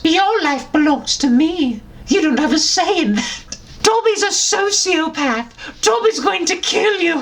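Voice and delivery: high squeaky elf voice; a little elf playing the villain; high house-elf voice, mock sinister; Dobby voice. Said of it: "Sorry, wrong voice".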